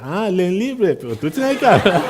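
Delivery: mock Chinese-accented Spanish with a laugh; imitating the Chinese grocer. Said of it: "Y eso es lo que quería".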